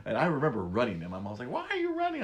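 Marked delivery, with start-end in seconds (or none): 1.5-2.2 high-pitched imitation of mom. Imitating mom's question.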